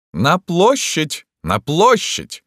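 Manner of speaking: high, loud, drawn-out call; imperious rallying cry; distant shout. A narrator's reading. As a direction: summon a crowd from afar. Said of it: "закричал издали император".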